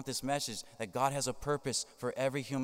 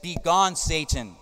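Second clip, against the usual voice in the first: commanding rebuke; 5 semitones higher, 8 dB louder, slower than usual. Retold or imitated. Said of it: "Jesus said".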